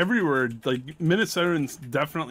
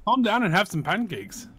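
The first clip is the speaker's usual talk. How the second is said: put-on raised, playful Midwestern accent; higher-pitched Midwestern ribbing; 8 semitones higher, 4 dB louder than usual. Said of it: "challenging me to a Midwestern off".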